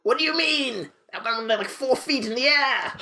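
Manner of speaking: high, drawn-out mock outrage; hammed-up indignation; indignant protest.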